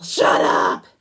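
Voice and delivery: harsh, explosive imitation of a yelling teacher; yelled shout. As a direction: belt it out like a teacher silencing the class. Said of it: "and yell".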